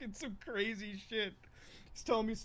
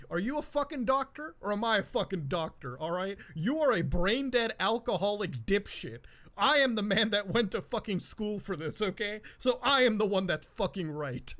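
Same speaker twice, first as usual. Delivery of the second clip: imitating furious dad; 2 semitones higher, 5 dB louder than usual. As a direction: put him in his place like a proud doctor. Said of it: "he was like".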